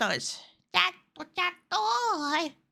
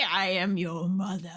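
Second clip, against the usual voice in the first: deep voice; 8 semitones lower than usual. Pretend. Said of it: "a voice warper".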